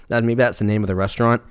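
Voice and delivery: ordinary talk, nothing marked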